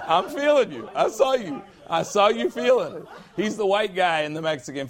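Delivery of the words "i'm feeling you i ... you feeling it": ordinary delivery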